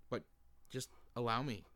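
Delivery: normal talking voice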